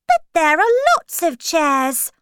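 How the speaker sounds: high, loud storybook protest; angry. A narrator's reading. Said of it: "Alice said angrily".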